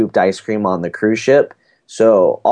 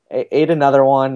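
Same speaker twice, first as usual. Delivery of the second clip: ordinary talk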